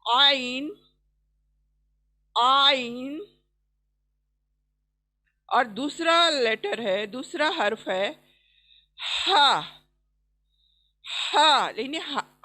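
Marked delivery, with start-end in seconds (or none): 0.1-3.3 आइन pressed from mid throat; throaty ain. Shown as a demonstration. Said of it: "उंगली लगाकर इसको अदा करना है". 9.0-11.7 हा with a rushing rasp from mid throat; raspy throat ha. Shown as a demonstration. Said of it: "हर आवाज झरेगी".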